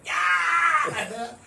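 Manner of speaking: a big high yell to spook the bull; sudden shout. Retold or imitated. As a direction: burst out with a startling yell. Startling the bull, jumping out.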